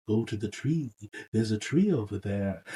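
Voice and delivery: low, hushed mysterious voice; weird voice. Retold or imitated. A weird voice tells the wolf to find a tree, hushed. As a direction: speak low and soft, like an eerie voice. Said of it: "like, a weird voice".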